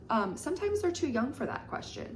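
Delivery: ordinary talk, nothing marked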